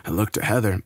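ordinary delivery, nothing marked

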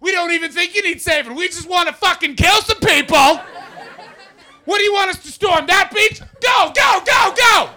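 high, loud eager soldier yelling; hammed-up war-hungry charge, shouting go; gung-ho Canadian soldiers. Comic telling the crowd how Canadians joined the war, yelling. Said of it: "We were there two weeks in being like".

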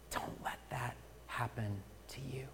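pastor's hushed plea to the church; pleading whisper. Real, not acted. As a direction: whisper the warning softly.

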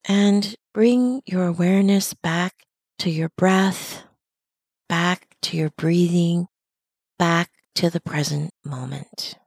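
calm, unhurried meditation guide, long pauses between phrases; measured mindfulness-teacher delivery with lingering silences; guided meditation. Acted. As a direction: guide listeners gently into their breathing, leaving space after each phrase. Leads a breathing meditation.

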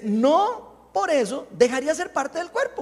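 high, loud proclaimed verse; scripture reading. Real, not acted. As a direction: read it out forcefully to the congregation. Reads scripture aloud. (lectura bíblica enérgica, en voz alta)

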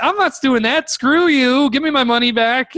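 high-pitched indignant protest; retold indignation. Buyer demands his money back from dealer, indignant. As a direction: refuse hotly, voice pushed up high. Acts his refusal to pay.

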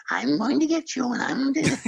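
high cackling witch threat. Retold or imitated. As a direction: menace the kids like a storybook witch. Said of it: "So the witch said".

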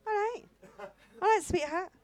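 high-pitched sweetheart reply; high mock-agreeable "all right"; acted reply. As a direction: answer in a high, put-on voice. Acted phone reply to request.

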